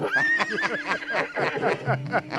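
shrill burst of laughter; sudden high laugh. Acted. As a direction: let out a high-pitched cackle. Outburst of laughter on stage.

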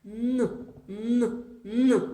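н, н, н hummed briefly; teacher modelling the quiet consonant н; soft n sound. Shown as a demonstration. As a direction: hum the н quietly. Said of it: "він тихо вимовляється".

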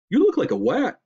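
high, loud, lisping moose. A narrator's reading. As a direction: say it loudly, r turned to w. Moose lisps rat as wat.

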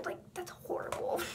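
whispered disgust; hushed, cringing whisper. Real, not acted. Reading old diary, disgusted.

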